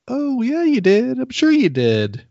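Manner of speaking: 목소리를 높여 능청스럽게 얼버무리는 엄마; mock innocent cover-up. Mom covering up, suspicious.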